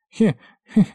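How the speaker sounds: high, halting little fake laugh; faltering chuckle. A narrator's reading. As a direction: squeeze out an awkward he-he. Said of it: "буксовал её голос".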